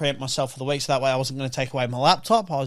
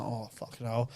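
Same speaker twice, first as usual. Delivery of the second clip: groggy groan; 5 semitones lower, 15 dB quieter than usual. Retold or imitated. Sore eyes after alarm.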